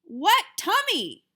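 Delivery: loud, high, slow shout; big brother shouting in disbelief. Acted. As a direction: shout it at the toddler. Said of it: "I shouted at my brother".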